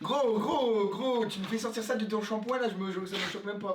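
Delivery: shocked, worked-up protest; excited disbelief. Real, not acted. Shocked reaction to food.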